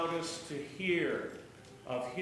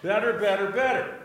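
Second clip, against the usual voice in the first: mock stroke-limited speech, louder; imitating mother's few words; 3 semitones higher, 6 dB louder than usual. Pastor mimicking the mother's words. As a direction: echo her halting words. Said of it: "is only can say".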